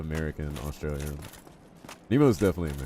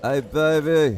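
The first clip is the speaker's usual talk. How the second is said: high, loud fawning call; gushing greeting; 10 semitones higher, 11 dB louder, much slower than usual. Real, not acted. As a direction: greet an adored character in a raised, excited voice. Gushing greeting to an admired game character.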